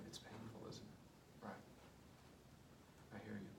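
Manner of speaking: hushed tender therapist voice; soft empathic validation. Shown as a demonstration. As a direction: lean in gently and soothe her pain. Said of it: "therapist demoing empathy".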